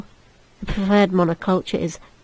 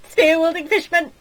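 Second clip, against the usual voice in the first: high, loud panicked cry; alarmed shout; 8 semitones higher, 9 dB louder than usual. Acted. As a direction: shout the warning. Alarm at fishmen.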